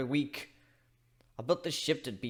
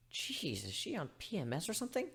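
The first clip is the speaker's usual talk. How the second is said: muttered aside; 4 semitones higher, 11 dB quieter than usual. Acted.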